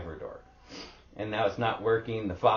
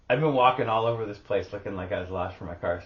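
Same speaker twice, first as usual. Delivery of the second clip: ordinary talk, nothing marked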